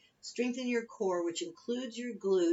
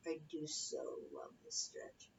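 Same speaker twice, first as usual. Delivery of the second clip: whispered aside; 4 semitones lower, 11 dB quieter than usual. Real, not acted. Aside during stretch.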